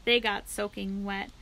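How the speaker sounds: ordinary delivery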